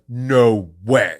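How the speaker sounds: loud, drawn-out imitation of a stunned fan; retold cry of amazed recognition; astonished fan. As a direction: blurt it out in mock disbelief. Fan's stunned recognition behind him.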